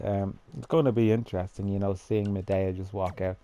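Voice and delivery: ordinary talk, nothing marked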